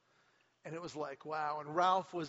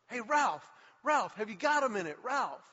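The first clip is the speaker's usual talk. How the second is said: raised, higher hail while jogging; calling after; 6 semitones higher, 7 dB louder than usual. Retold or imitated. Nicklaus jogging after Ralph to stop him, loud calling. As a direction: call him back, eager and loud.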